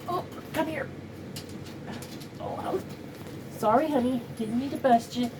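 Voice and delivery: soft, tender pet talk, partly whispered; cooing to the dog. Real, not acted. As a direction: comfort the dog gently. Soothing her dog.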